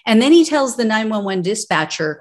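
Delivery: ordinary talk, nothing marked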